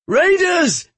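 high, loud cry of warning; shouted alarm. A narrator's reading. Fleeing injured man warns executioner of attack, shouted alarm. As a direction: sound the alarm at the top of your range. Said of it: "he shouted at me".

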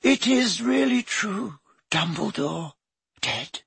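high, slow breathless whisper; a shocked portrait whispering. A narrator's reading. The fat lady to Harry, whispering high.

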